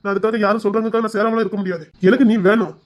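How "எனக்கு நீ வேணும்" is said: a little louder, firm; earnest declaration. Acted. Husband insisting to his wife, firmly. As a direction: say it with conviction.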